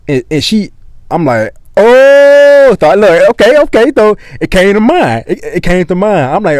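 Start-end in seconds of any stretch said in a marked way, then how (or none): 1.8-2.8 long, high, loud "oh"; drawn-out loud oh of dawning recall; sudden realization. Retold or imitated. Retold sudden moment of remembering. 2.8-6.4 high-pitched, worked-up recollection; animated, pitched-up "now I remember"; acted sudden recall. Retold memory suddenly coming back.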